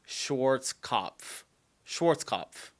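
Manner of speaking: slow Schwarzkopf as SHORTS-kopf, no v; own guessed pronunciation. Shown as a demonstration. American viewer tries an unfamiliar brand name, Schwarzkopf as a slow SHORTS-kopf. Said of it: "I would pronounce it".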